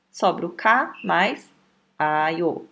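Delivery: ordinary talk, nothing marked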